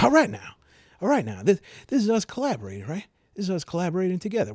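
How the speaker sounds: suddenly high, loud and fast; a burst of eager buddy energy; excited. Acted. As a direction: fire up with a clap, eager to team up. Clapping, eager to team up.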